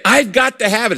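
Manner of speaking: high, urgent demand; passionate insistence. Acted. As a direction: insist on it with pressing need. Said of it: "intensity or passion in your prayer".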